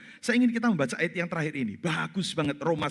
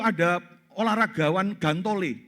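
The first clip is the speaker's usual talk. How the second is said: ordinary talk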